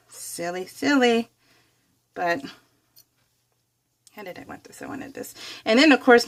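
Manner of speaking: ordinary talk, nothing marked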